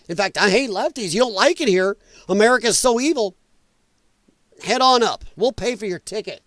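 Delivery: ordinary talk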